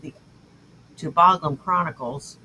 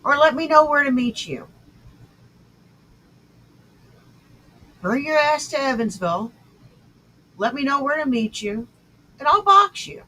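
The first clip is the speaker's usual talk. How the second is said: raised challenge; 7 semitones higher than usual. Real, not acted. Challenging rival to fight.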